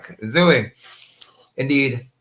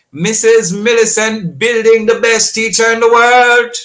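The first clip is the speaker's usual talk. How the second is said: high, loud tribute to a teacher; rousing on-air acclaim; proud shout-out; 9 semitones higher, 9 dB louder than usual. Real, not acted. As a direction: belt out the praise on a held high pitch.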